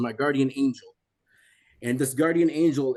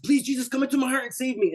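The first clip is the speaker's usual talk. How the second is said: much higher, fast, frantic plea; desperate prayer; 11 semitones higher, faster than usual. Retold or imitated. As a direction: cry out to be saved.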